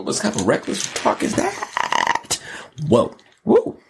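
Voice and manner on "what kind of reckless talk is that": high, slow mock shock; hammed-up disbelief; mock outrage. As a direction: act scandalized, drawn out. Mock outrage at reckless talk.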